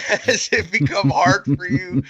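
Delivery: drawn-out words through laughter; laughing. Real, not acted. Asking his friend if he is in a drought, laughing. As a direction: tease a friend, struggling not to laugh. Teasing co-host while cracking up.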